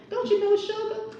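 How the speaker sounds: high put-on southern voice; hammed-up impression of a movie character. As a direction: squeak it out in a put-on voice. Voicing a character.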